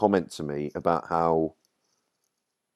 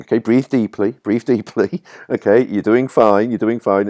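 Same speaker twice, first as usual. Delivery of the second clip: louder, firm soothing coaching; calming someone; 5 semitones higher, 6 dB louder than usual. Retold or imitated. Gary calming the panicked writer on the steps, firm and soothing. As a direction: talk a panicking friend down. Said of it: "just saying".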